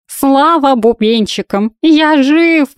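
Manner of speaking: high, slow cat yell, leaping to a shriek. A narrator's reading. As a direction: shout it as a frazzled talking cat. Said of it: "заорал".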